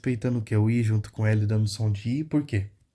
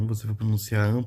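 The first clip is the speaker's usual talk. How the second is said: ordinary delivery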